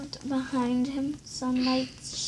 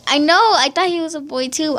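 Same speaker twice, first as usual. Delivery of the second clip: excited; 3 semitones higher, 13 dB louder, faster than usual. Real, not acted. Surprised reaction to the picture.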